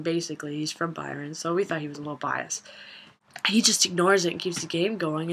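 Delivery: ordinary delivery, nothing marked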